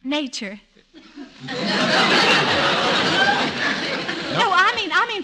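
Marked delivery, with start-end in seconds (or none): 1.0-4.3 high breathy giggle rising; light teasing giggle, high and airy; giggling laugh. Acted. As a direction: giggle at your own joke. Wife laughs at "nature" joke.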